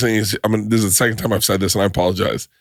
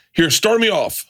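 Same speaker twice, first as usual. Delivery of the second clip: ordinary delivery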